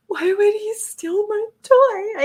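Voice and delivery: put-on high, slow whispered child complaint; upset little girl. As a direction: whisper like a wronged little kid. Upset daughter quoted.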